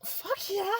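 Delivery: high, eager mock cheer; thrilled high-pitched fuck yeah; excited yes. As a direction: squeal it like free food just landed. Retold eager reply to nuggets.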